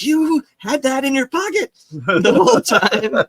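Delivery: mild mock astonishment; friend's disbelief. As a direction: stretch it out in amazement. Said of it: "he was like".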